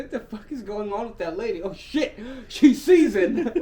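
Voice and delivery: hammed-up disbelief, cracking up; mock shocked onlooker. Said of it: "She's like".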